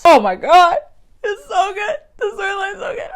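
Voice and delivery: raving about the alternate Batman story, near tears; excited. Real, not acted. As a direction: gush over the storyline, voice breaking up.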